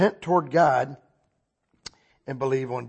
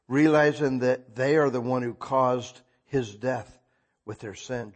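ordinary talk, nothing marked

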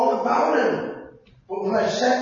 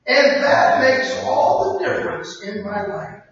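louder, slow prayer led for the crowd to repeat; leading a prayer aloud; 3 semitones higher, 7 dB louder than usual. Acted. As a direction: pray it out loud with them. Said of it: "Can you say that with me?".

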